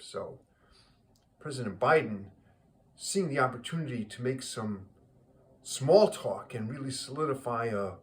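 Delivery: ordinary talk, nothing marked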